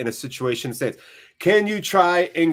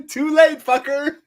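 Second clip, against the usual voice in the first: high-pitched squeal through laughter; gleeful laughing; 12 semitones higher, 5 dB louder than usual. Real, not acted. Streamer cracking up at his chat, laughing high. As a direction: crack up laughing while saying it.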